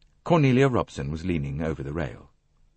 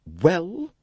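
higher, drawn-out rising demand; ungracious; 12 semitones higher than usual. A narrator's reading. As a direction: prompt him impatiently. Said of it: "held the same ungracious tone".

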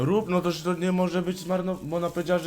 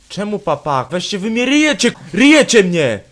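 loud angry outburst; 6 semitones higher, 13 dB louder than usual. Real, not acted.

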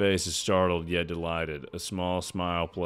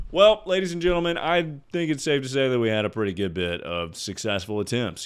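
mock announcer; 5 semitones higher, 4 dB louder, faster than usual. Real, not acted. Announcer address.

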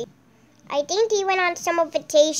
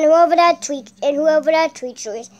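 ordinary talk

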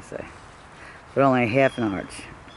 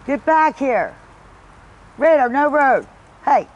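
loud, high shout to the dog; calling from far away; 9 semitones higher, 7 dB louder than usual. Real, not acted. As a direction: yell the dog back from the road. Calling dog back from road.

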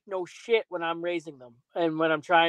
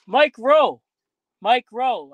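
higher and louder call of the name; 5 semitones higher, 9 dB louder, much slower than usual. Acted. Host hails a celebrity guest he hopes for, calling out loud. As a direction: shout it like hailing someone.

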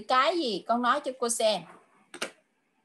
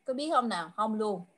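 usual speaking voice

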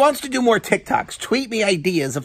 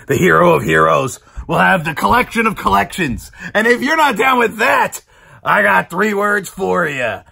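wrestling promo climax; 3 semitones lower, 6 dB louder than usual. Pretend. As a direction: proclaim the sign-off, stretching the last word.

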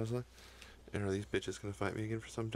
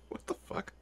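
ordinary talk, nothing marked

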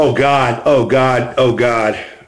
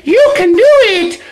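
high, hammed-up encouragement; mock cheerleader; 10 semitones higher, 4 dB louder than usual. Coach playing a special person motivating you, mock cheering. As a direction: cheer them on in a pitched-up voice.

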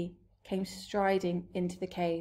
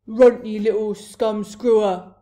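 very loud giant bellow; shouted insult; 13 dB louder than usual. A narrator's reading. Blood Bottler abusing the BFG, angry shout. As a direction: bellow abuse at the smaller giant. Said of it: "he shouted".

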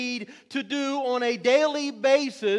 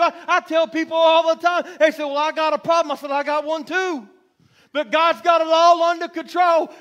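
raised, driving pulpit testimony; fervent preaching; 4 semitones higher, 6 dB louder than usual. Real, not acted. Preacher testifying that God still kept them, fervent preaching. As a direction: press each point home with rhythmic force.

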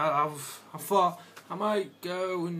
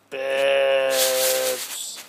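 silly voice; 7 dB louder than usual. Pretend. Jokey silly exclamation.

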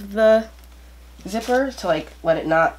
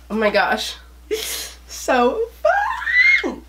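excited squeal; slower than usual. Real, not acted. First feel of the coat.

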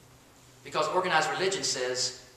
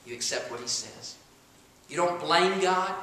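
usual speaking voice

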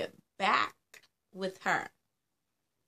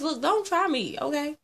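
higher, louder mock sass; sassy warning; 8 semitones higher, 6 dB louder than usual. Vlogger warning viewers who judge her, mock sassy. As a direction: playfully dare them to try it.

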